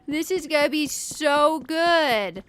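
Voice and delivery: gushing with giddy anticipation; giddy excitement. Acted. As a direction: stretch it out like you can't wait. Repeats line, drawn out excited.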